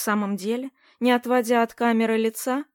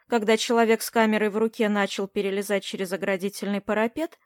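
ordinary delivery

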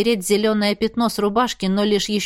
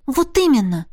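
heated; 4 semitones higher than usual. A narrator's reading. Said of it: "запальчиво продолжила я".

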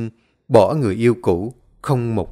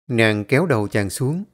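plain reading voice

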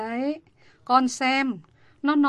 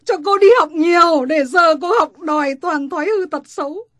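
louder and higher, berating shout; furious shout; 5 semitones higher, 7 dB louder than usual. Acted. Mother condemning her daughter's ways, furious shouting. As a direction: tear into her for her shameful ways.

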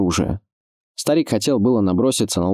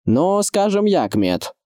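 high opening dropping to usual pitch; raised pitch settling at the end; village head calling down; 11 semitones higher, slower than usual. A narrator's reading. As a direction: answer guardedly from the wall. Village head answers from the wall.

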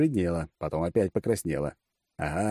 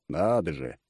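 ordinary delivery, nothing marked

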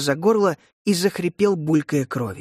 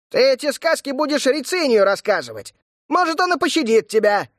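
shouting; 8 semitones higher than usual. A narrator's reading. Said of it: "крикнул Фли".

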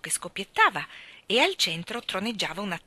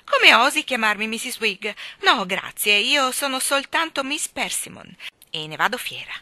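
much louder, higher affronted protest; thin indignant spinster; 4 semitones higher, 8 dB louder than usual. A narrator's reading. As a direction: bristle with offended pride. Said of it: "con una voce ancora più sottile di lei".

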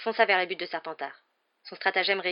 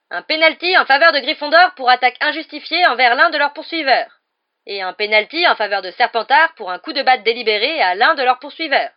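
loud, raised-pitch official ruling; stern referee; 7 semitones higher, 13 dB louder than usual. A narrator's reading. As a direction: proclaim the penalties to the whole pitch. Referee announces penalties as madame Bibine. (arbitre qui proclame la sanction)

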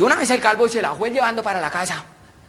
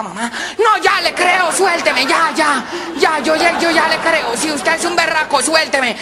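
begging shout; 7 semitones higher, slower than usual. Acted. Tied-up girl shouting at the lover to let her go. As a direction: yell at him to untie her.

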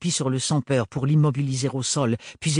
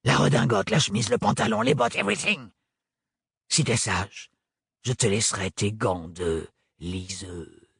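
mocking menace; 4 semitones lower than usual. Sans-peur lists clothes, mocking.

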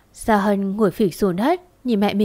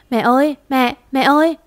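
frantic louder calls for mum, rising high; panicked calling; 4 semitones higher, 4 dB louder than usual. A narrator's reading. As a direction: call out for her mother in alarm. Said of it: "Gia Hân hoảng hốt gọi mẹ". (hoảng hốt gọi mẹ)

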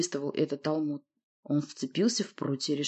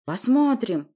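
growling, raised challenge; growled reply; 6 semitones higher, 4 dB louder, slower than usual. A narrator's reading. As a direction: growl it back defiantly. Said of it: "прорычал я в ответ".

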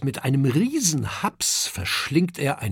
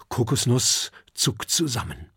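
plain reading voice

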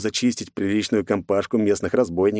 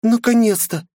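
put-on high child's exclamation, loud; creepy child; 5 dB louder than usual. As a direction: shout it as an eerie little girl. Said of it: "воскликнул детский голосок".

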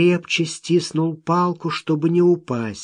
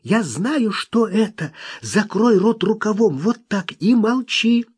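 urgent alarm; 6 semitones higher than usual. A narrator's reading. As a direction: press them urgently to stay silent. Lobiskvi warns urgently.

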